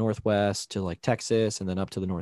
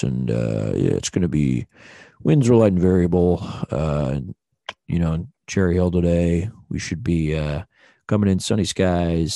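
lower, smooth, unhurried captain weather report; smooth operator pilot voice; 3 semitones lower, 5 dB louder than usual. Retold or imitated. Pilot explaining the meme, lower smooth radio voice. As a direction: soothe the cabin like a smooth pilot. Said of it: "smooth operator voice".

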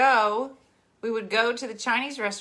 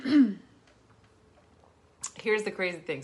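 usual speaking voice

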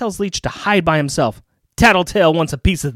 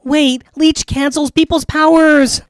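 put-on kid hero voice; 11 semitones higher, 4 dB louder than usual.

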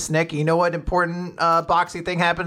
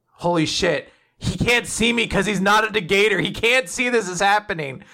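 nervous mock panic; 5 semitones higher than usual. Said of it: "She's like".

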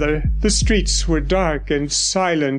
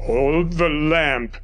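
slow, low, hushed order; steady your helper in the dark; low tense doctor; 4 semitones lower, slower than usual. A narrator's reading.

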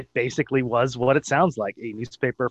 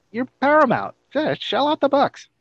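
much higher, playful plea to the studio; mock appeal; 12 semitones higher, 4 dB louder than usual. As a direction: call out to Paramount, high and louder, half-joking.

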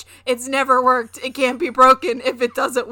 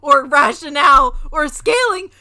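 mocking quote; 4 semitones higher, 9 dB louder than usual. Mocking opponent's logic, laughing.

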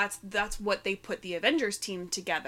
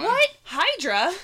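mock innocent; 10 semitones higher, 8 dB louder, slower than usual. Mock ignorant Hydra voice.

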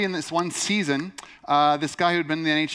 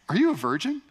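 nosy roommate question; 6 semitones higher than usual. Retold or imitated.

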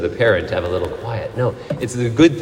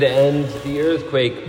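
ordinary talk, nothing marked

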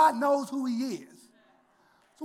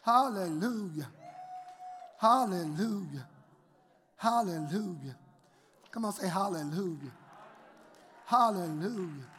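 fading hallelujahs; 5 semitones lower, 6 dB quieter than usual. Real, not acted. Fading call to congregation.

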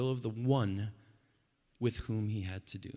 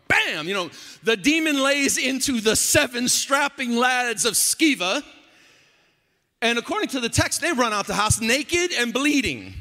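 high, loud comic exasperation from the pulpit; loud, high, incredulous storytelling; animated preaching; 11 semitones higher, 9 dB louder than usual. Real, not acted. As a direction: belt out the story, amused and worked up. Preaches at full force.

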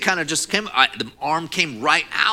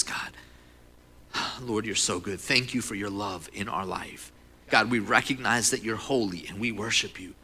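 softer, lower voice of reverent prayer; 5 semitones lower, 4 dB quieter than usual. Real, not acted. Pastor leads church in prayer before the message, reverent. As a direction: pray gently, sinking to a hushed amen.

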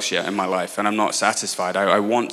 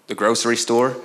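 joking try at an American accent; lightly mocked-up American accent. Shown as a demonstration. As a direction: say it with a put-on American twang. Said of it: "that was my American accent".

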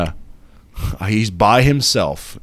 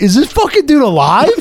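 incredulous retold self; 13 semitones higher, 6 dB louder than usual. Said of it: "I'm like".

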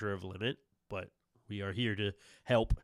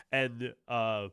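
ordinary talk

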